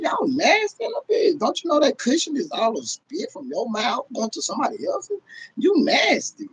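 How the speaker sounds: calling viewers nasty, high and appalled; high disgusted rant. Real, not acted. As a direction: pitch it way up, appalled. Disgust at shared spit.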